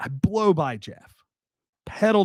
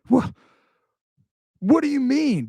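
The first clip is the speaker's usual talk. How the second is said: high, winded demand, much higher; breathless guard; 11 semitones higher than usual. Retold or imitated. Guard who chased the car confronts the driver, high breathless demand. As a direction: gasp out an indignant question after running. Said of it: "red face, uh, sweating, panting".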